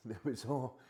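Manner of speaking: ordinary talk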